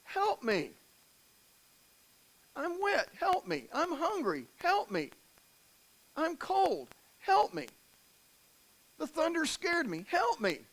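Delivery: put-on high-pitched helpless baby pleas; short, high cries for help, playing a needy infant. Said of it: "what's the expectation when you're a baby".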